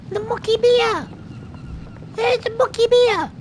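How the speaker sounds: very high piping character voice; chirpy high-pitched mock voice; squeaky high voice. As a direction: pitch way up like a tiny creature. Squeaky voice naming the beer.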